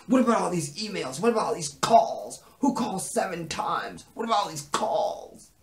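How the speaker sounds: high, hammed-up accusing questions; jealous interrogation. Acted jealous interrogation by [name].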